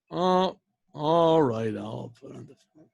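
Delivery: acted weary surrender; reluctant giving in. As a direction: act out grudgingly caving, very slowly, trailing away. Retold man finally giving in.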